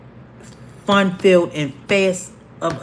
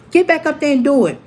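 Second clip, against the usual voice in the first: teacher's order; 6 semitones higher, 3 dB louder, faster than usual. Retold or imitated. Teacher orders her back up, retold.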